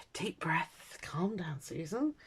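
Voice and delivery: softly whispered to herself; whispered self-calming. Retold or imitated. Woman whispering to herself to stay calm. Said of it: "And I went".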